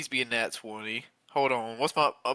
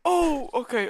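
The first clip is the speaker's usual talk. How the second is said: dread; 13 semitones higher, 4 dB louder, much slower than usual. Real, not acted. Dreading the d4 roll.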